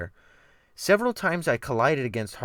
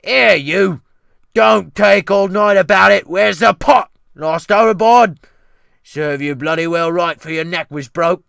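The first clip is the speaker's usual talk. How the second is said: high, loud Cockney abuse; angry shouting cook; 8 semitones higher, 13 dB louder than usual. Acted. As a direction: scold him at full volume. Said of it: "shouting through the lee galley door".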